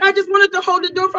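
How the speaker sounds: high, hurried protest; imitating pleading excuse. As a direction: explain yourself quickly in a high, pleading voice. Frightened man explaining himself, imitated.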